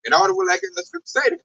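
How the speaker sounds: ordinary talk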